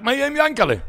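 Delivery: high, loud cry for his brother; shout. Retold or imitated. Menish shouting for his brother Yankele, high and loud. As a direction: yell the question out, pitched high. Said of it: "הוא צעק".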